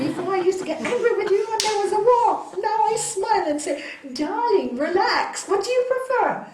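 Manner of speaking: lively mock sweet reply to husband; animated retelling. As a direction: swept up telling it. Retelling soothing her husband.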